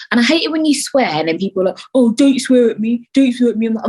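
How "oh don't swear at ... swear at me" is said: slightly higher, louder mimicked objection; mock complaint. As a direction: mimic people taking offence. Mimics people complaining about swearing.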